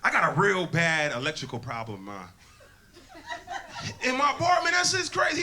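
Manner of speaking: ordinary delivery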